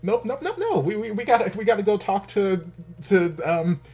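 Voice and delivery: high, loud exasperated nopes. Acted. Vlogger voicing the episode refusing to end, high and loud. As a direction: keep shouting the show's refusal.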